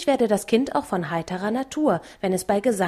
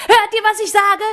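very high, loud giddy shout; joyful shout; 12 semitones higher, 6 dB louder than usual. A narrator's reading. Mattes cries to the robbers in the hall, very high and loud. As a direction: shout with mad joy. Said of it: "schrie dabei wie närrisch".

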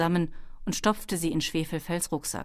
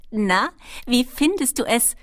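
Schwefelfell's high, raised kobold voice; calling out; 6 semitones higher, 6 dB louder than usual. A narrator's reading. Kobold girl checks on Ben mid-flight, calling out. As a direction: call it over to a fellow flier, higher and louder. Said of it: "rief Schwefelfell Ben zu".

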